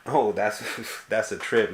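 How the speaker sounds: ordinary talk, nothing marked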